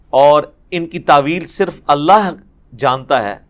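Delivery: normal talking voice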